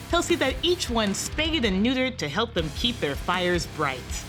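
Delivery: usual speaking voice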